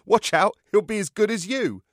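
high, loud teasing voice; teasing guest. A narrator's reading. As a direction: rib him playfully.

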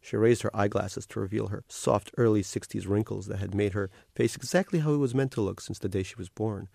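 ordinary delivery

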